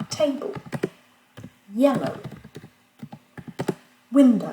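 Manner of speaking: "table", "yellow", "window", each punched on its first syllable; stress on first syllable. Shown as a demonstration. Teacher giving English examples, "table", "yellow", "window" stressed on the first syllable. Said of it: "accentués sur la première syllabe".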